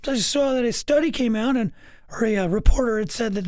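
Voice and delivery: high-pitched mock voice of a defensive co-host; squeaky-high imitation, relaying a report; passive-aggressive Brady. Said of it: "He was passive-aggressive about Eric".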